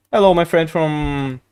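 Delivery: ordinary talk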